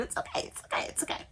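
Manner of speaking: soft unvoiced whisper to herself; whispered reassurance. Real, not acted. To herself on the hard floor, a soft whisper. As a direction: whisper it's okay to yourself. Soothing herself about sore elbows.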